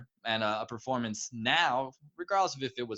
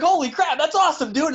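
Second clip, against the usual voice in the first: high, loud amazed outburst; re-enacted elated disbelief; thrilled; 9 dB louder than usual. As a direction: exclaim it like a giddy fan. Retelling thrill at the discovery.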